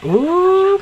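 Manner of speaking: high, drawn-out teasing "ooh". Real, not acted. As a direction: react to juicy gossip. Reacting to the quarrel.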